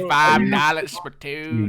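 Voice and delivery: slow lazy cowboy drawl; imitating a cowboy, slowly. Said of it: "On cowboy mode".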